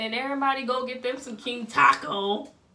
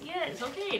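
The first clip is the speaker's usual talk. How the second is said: usual speaking voice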